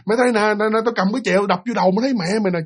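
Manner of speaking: high-pitched acted cursing rant; noisy cursing. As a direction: scold and threaten in a high, agitated voice. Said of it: "chửi sùm hết trơn". (giọng cao, chửi sùm)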